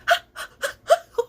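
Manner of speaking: high, loud unvoiced shriek like a laugh; scream-laugh. Showing how her scream sounds, shrieking laugh. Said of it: "when I scream, people think I'm laughing".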